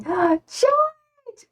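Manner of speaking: sudden high squeal after a gasp; gasp, then call the name out very high; excited greeting. Real, not acted. Streamer spots George in the chat, real excited greeting.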